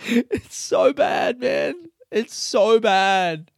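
drawn-out high wail of misery; anguished wail. Real, not acted. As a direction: wail it out like the itch is unbearable. Groaning over the itchy rash.

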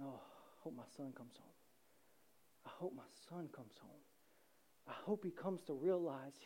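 very soft, low wishful murmur; hope aloud, half to yourself; hushed, with long pauses; acted longing father. Father waiting on the porch.

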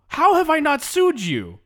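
high, loud indignant yell; comic outrage at the lawyer; outraged shout. Acted.

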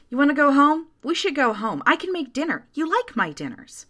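anxious coaxing. A narrator's reading. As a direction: coax them to go home, voice lifted. Janet anxiously coaxes narrator home.

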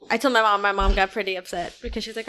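ordinary talk, nothing marked

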